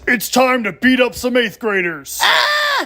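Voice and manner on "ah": high-pitched yelp of panic; frightened scream. Acted. Eighth grader under attack, high scream. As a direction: shriek as the attack begins.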